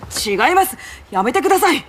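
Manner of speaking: high, stammered protest; agitated denial. Acted. Retainer denies her heart aches, agitated. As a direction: push the question away, upset. Flustered denial when asked about pain.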